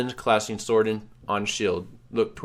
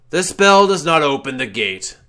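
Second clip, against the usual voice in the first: loud, higher ghostly pronouncement; the wraith sets the hero straight; solemn correction; 6 semitones higher, 10 dB louder than usual. Acted.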